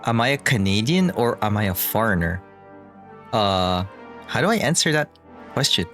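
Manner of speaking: usual speaking voice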